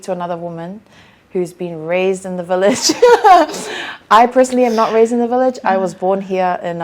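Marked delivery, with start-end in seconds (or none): 3.0-4.1 laugh. Real, not acted. Laughing at her own remark.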